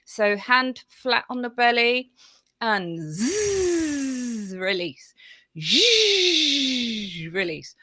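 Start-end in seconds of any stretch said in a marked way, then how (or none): 3.0-4.6 a steady buzzing zzz; voiced buzz. Shown as a demonstration. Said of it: "we're going to do some voiced sounds". 5.6-7.3 a steady zh, pitched higher; voiced zh. Shown as a demonstration. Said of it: "we're going to do some voiced sounds".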